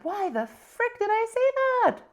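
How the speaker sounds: high, drawn-out retold dismay; mock self-reproach. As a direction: stretch it out high in mock disbelief. Retells instant regret at agreeing.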